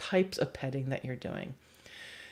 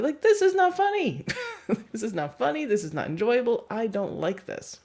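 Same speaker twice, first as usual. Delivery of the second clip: mock annoyed protest; 7 semitones higher than usual. Guest pushing back at the joker, high mock irritation. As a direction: insist firmly that it is not funny.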